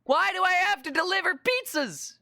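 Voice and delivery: sustained high-pitched protest; despairing gamer outcry; exasperated wail. Real, not acted. Protests the game task.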